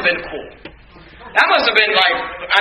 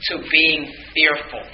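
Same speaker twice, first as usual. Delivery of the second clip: slow, grave emphasis; 5 semitones lower, 4 dB quieter, much slower than usual. Shown as a demonstration. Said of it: "I want you to see".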